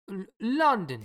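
lightly put-on Italian accent; a hint of Italian lover. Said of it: "my Italian accent".

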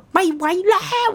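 high, drawn-out wail; long high whiny cry; wailing can't take it. Retold or imitated. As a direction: stretch it out like a wailing toddler. Child crying nonstop in car.

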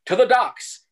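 high, loud mock rallying cry; hammed-up heroic charge. Characters rally to docks.

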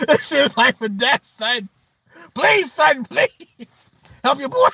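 high, hammed-up desperation; pleading kid. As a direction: plead as if life depends on it. Acting out desperate begging for shoes.

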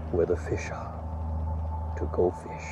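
breathy secret whisper. Acted. Dramatic whispered moral.

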